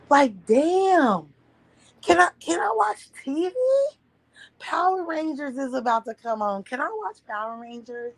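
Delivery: put-on high, whiny child begging; whiny kid. As her childhood self whining at the boy outside. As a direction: plead like a kid stuck indoors.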